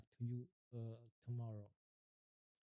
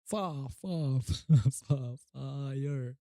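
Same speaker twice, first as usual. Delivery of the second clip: laughing stammer; 9 dB louder, much slower than usual. Real, not acted. Stuttering on fire, laughing.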